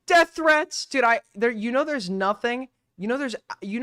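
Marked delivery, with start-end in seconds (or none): 0.0-0.8 high, loud, drawn-out mock alarm. Streamer echoing a video's complaint, mock alarmed. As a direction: squeal it slowly in fake dismay.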